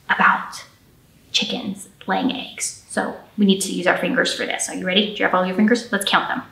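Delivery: ordinary talk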